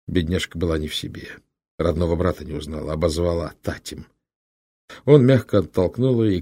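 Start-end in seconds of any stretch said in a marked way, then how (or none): none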